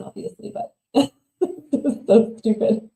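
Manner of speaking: words broken by real laughter; giggling at her own joke; laughing. Real, not acted. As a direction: laugh through the line.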